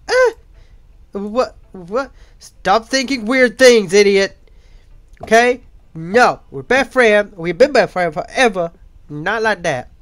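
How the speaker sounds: put-on loud, high flustered girl; flustered shout, high and loud. Sayori flustered, denies.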